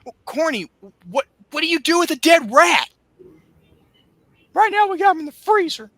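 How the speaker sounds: high, squeaky Cornette voice; Cornette impression. Bruce's friend on the phone, in a high squeaky whine. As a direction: squeal it out like a frantic southern manager.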